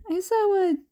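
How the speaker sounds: usual speaking voice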